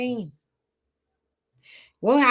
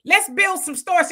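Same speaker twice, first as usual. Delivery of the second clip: firm pretend advice; 8 semitones higher, 5 dB louder, slower than usual. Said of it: "Instead of saying".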